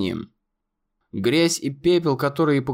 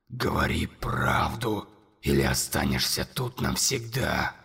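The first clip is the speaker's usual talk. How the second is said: merciless, menacing; 10 semitones lower, 7 dB quieter than usual. A narrator's reading. Monster demanding the truth, menacing. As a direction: command him without pity. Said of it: "безжалостным и грозным".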